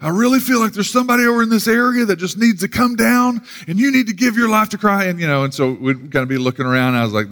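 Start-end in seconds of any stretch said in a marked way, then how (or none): 0.0-5.1 high, far-carrying preacher's appeal; imitated pastor. Pastor singling out visitors in the seats, imitated altar call. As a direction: do a pastor urging someone in the crowd forward. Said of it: "usually that pastor would pick out".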